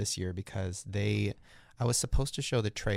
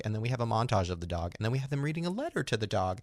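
ordinary delivery

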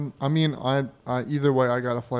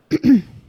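clearing throat; 10 semitones higher, 13 dB louder than usual. Shown as a demonstration. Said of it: "Try to hail a cab".

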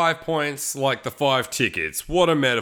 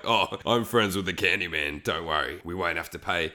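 mock smug kid; 11 semitones lower, 5 dB quieter than usual. Imitating smug kids at candy shop.

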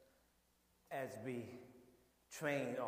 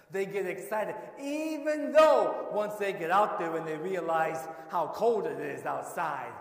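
loud, high, building preaching; preaching at full force; 7 semitones higher, 14 dB louder than usual. Acted. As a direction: drive the story home to the congregation.

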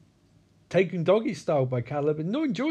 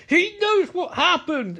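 high, drawn-out acted outburst; mock accusing yell; 14 semitones higher, 4 dB louder, slower than usual. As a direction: blurt it out in exaggerated indignation. Acted quarrel, [name] accusing.

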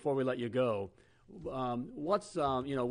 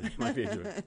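laughing through the words; chuckling high while repeating it; 7 semitones higher than usual. Real, not acted.

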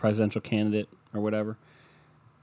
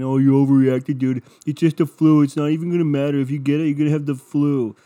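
loud, higher-pitched imitation of dismissive people; mocking scoffers; 5 semitones higher, 7 dB louder than usual. People laughing at his flu worries, mockingly imitated. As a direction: mimic them loudly brushing it off. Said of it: "people were laughing at me, saying".

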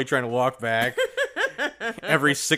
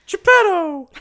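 high loud puppet call; Pinocchio voice; 10 dB louder, slower than usual. Acted. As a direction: call out like a wooden boy. Voicing Pinocchio calling.

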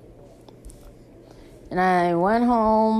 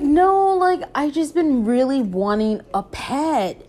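excited no; 5 semitones higher than usual. Retold or imitated. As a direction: burst out with a keen no. Excitedly refusing friend's offer.

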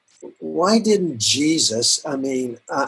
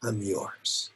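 humble prayer; 4 semitones lower, 6 dB quieter than usual. Pretend. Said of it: "humble myself and say".

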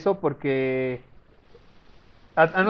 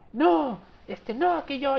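put-on whiny child; 9 semitones higher, much slower than usual. Child whining, retold.

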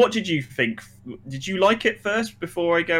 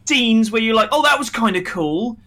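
worked-up rant; 7 semitones higher than usual. Real, not acted. Continued rant.

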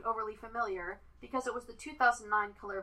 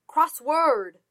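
loud, high shout of the word; 6 semitones higher, 10 dB louder than usual. A narrator's reading. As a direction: yell it at her so she hears. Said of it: "shouted Moss".

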